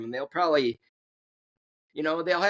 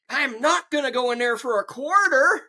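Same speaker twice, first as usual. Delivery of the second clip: stubborn punchline; 7 semitones higher than usual. Retold or imitated. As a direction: deliver the punchline like a cheapskate. Punchline quote of the man.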